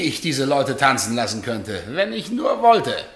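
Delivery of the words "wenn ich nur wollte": high, loud and slow; a slow, loud gloating threat; menacing boast. Acted. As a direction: savour the threat. Husband boasts of his power.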